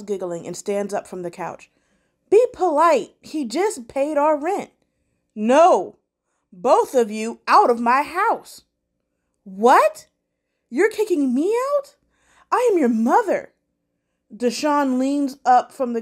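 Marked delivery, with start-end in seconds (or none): 2.3-4.7 scolding mother. Acted. 5.3-8.6 loud furious order; angry shout. Acted. Derek throws them out. 9.4-13.5 high, loud outraged mother voice; indignant mother. Acted.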